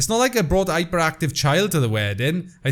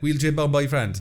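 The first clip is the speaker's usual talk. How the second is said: ordinary talk, nothing marked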